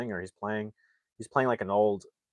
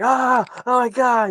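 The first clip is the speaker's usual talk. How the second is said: high, loud, drawn-out cry of disgust; mock freak-out; 9 dB louder than usual. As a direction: act out a horrified freak-out, stretched and high. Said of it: "He's, like, freaking out".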